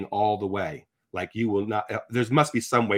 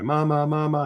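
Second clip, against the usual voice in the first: higher-pitched mock cry for mother; imitated crying out; 6 semitones higher than usual. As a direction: imitate a dying soldier's plaintive call. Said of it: "he's crying out for his mother".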